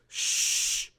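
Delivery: lightly voiced shushing; Sage. Game master as Sage shushing the party, lightly in character. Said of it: "Sage looks at you and goes".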